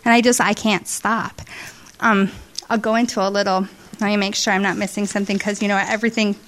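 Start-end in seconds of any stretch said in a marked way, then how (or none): none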